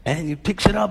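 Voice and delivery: normal talking voice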